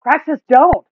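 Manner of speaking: high, loud warning yell. A narrator's reading. Gub trying to stop Praxis striking the turtle, yelling. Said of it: "Gub yells".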